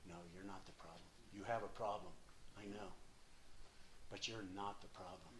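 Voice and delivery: soft, low and tender reassurance; a gentle voice of truth, hushed. Retold or imitated. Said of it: "this gentle truth saying".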